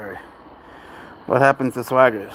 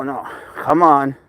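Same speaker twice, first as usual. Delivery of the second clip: usual speaking voice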